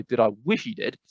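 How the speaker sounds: normal talking voice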